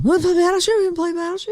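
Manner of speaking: put-on high, sing-song child begging to play; coaxing kid voice. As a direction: ask like an eager little kid. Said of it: "I used to play with my kids".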